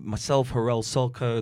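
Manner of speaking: normal talking voice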